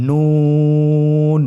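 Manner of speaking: নুন stretched very long, thin and loud; drawn-out letter name. Shown as a demonstration. Teacher introducing the next letter, long drawn-out নুন. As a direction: hold নুন for three counts. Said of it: "আপনারা আমার সাথে বলুন".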